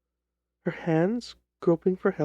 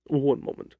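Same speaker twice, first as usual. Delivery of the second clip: lower, measured detective voice; a calm, lower Holmes cutting in; 4 semitones lower than usual. Acted. Holmes interrupts the client.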